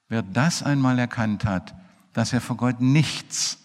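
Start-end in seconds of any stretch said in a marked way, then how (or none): 2.8-3.6 nichts hurled out loud and higher; preacher driving home the sinner's nothingness; punched word. Real, not acted. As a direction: hit nichts hard.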